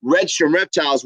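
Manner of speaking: usual speaking voice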